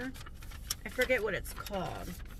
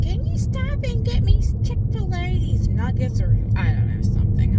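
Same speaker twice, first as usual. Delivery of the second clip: daughter's request; 10 semitones higher, 13 dB louder than usual. Retold or imitated. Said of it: "Lexi was like".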